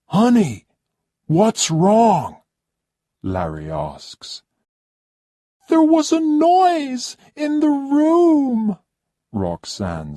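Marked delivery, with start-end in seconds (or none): 0.1-2.4 higher and louder, a concerned husband; lightly voiced anxious husband; worried husband. Larry voiced by narrator. 5.7-8.8 much higher and louder, a demanding whine; haughty high-pitched complaining lady; spoilt rich guest. Acted. Roxanne frightened in bed.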